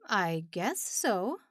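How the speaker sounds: slow, hesitant answer; unsure, feeling his way; tentative. A narrator's reading. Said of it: "answered tentatively".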